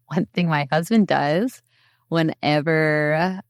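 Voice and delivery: ordinary delivery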